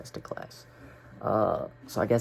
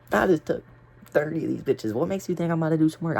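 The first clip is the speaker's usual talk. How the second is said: hyped brag; 5 semitones higher, 6 dB louder than usual. Retold or imitated. Boasting about taking pills.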